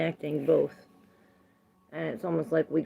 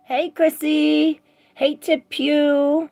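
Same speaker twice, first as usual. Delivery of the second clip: sing-song greeting; 9 semitones higher, 6 dB louder, slower than usual. Real, not acted.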